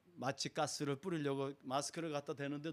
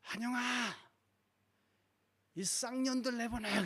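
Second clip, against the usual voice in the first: much higher, slow, strange raging voice; possessed sister; 10 semitones higher, 4 dB louder, slower than usual. Retold or imitated. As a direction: curse them out like something else is speaking through her. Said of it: "저한테 이렇게 하더니".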